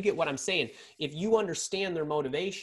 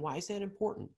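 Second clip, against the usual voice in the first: softer and higher, curious and caring; kind, gentle question; 5 semitones higher, 7 dB quieter, faster than usual. Shown as a demonstration. Coach modelling active listening, soft and kind. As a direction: ask gently, inviting them to open up. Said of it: "in the kindest, most em-, empathetic way".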